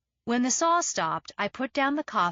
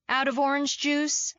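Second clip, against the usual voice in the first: mock pointed jab; 6 semitones higher than usual. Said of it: "making a show of checking my watch".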